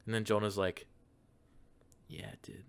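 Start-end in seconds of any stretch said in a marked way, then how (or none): 2.1-2.6 breathy whispered reply; dreamy whisper. Acted. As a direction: whisper it, smitten. Jonah answers dreamily, voiced.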